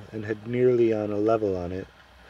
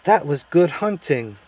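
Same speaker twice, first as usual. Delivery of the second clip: higher, louder exclamation; exclaiming prince; 5 semitones higher, 5 dB louder than usual. A narrator's reading. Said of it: "exclaimed the prince".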